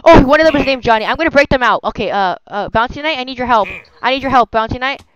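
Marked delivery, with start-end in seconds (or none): none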